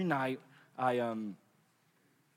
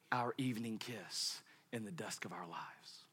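whisper; 6 semitones lower, 15 dB quieter than usual. Acted. Preacher dropping to whisper.

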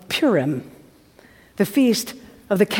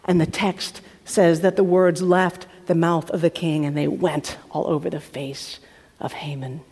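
ordinary delivery

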